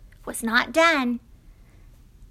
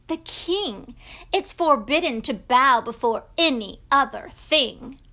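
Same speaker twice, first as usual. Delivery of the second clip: loud, emphatic royal decree; swooping, declamatory command; princes dictating a law; 2 semitones higher, 4 dB louder than usual. Acted. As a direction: proclaim it like a pompous official.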